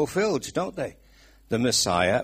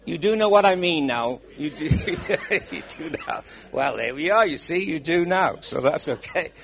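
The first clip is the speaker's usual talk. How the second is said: laughing; 5 semitones higher, 6 dB louder than usual. Real, not acted. Laughing at accent confusion.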